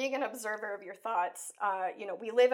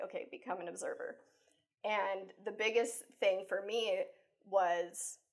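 usual speaking voice

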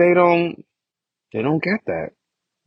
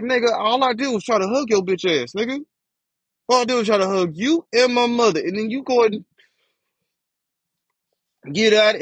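pitched way up, heated and exasperated; indignant high retort; 10 semitones higher than usual. Retold or imitated. Retelling his angry reply to his uncle, high indignant outburst. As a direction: fire it back at him, outraged.

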